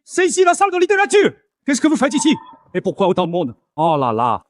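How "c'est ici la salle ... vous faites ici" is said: high-pitched, indignant challenge; alarmed protest. Acted. Student challenges people in the literature room, alarmed protest. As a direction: confront the intruders in outrage. Proteste contre des intrus.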